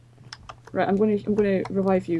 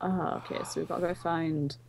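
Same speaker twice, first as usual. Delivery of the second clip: slipping into an English accent; quiet, lower-pitched English accent; 4 semitones lower, 11 dB quieter than usual. Real, not acted. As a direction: drift softly into English-sounding speech. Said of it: "I don't know why I went English there".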